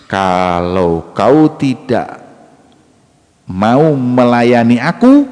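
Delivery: very slow, drawn-out ultimatum; deliberate, menacing role-play; slow acted threat. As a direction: stretch each word as a threat. Woman threatens the young man.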